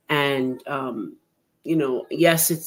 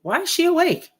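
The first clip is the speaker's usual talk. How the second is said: much higher, startled doctor asking; surprised doctor; 13 semitones higher, 3 dB louder than usual. Retold or imitated. Doctor asks why the crash victim is awake, high surprise. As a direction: retell the doctor's disbelief, high and sharp. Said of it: "he says to me".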